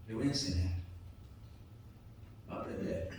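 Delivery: soft, acted fatherly forbearance; sigh, then quietly resign yourself to teaching him; deep-breath patience. God patiently looking at his erring son, soft after a deep breath. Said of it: "respira profundamente y dice".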